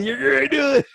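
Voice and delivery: laughing jeer at his friend; laughing shout. Real, not acted. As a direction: crow at him through laughter. Teasing friend to blurt it.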